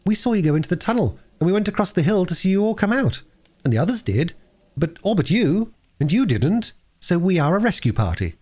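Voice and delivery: put-on mildly boastful, high-pitched boy; proud. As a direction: announce the rescue with a touch of pride. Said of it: "said Peter with pride".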